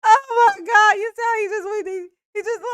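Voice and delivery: loud, high outburst after laughing; amused outburst. Real, not acted. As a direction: exclaim it high, still cracking up. Cracking up after own joke.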